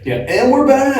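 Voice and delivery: high, bright hyped radio host. Acted. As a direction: pump it up like a morning DJ. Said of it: "talking like a radio D J".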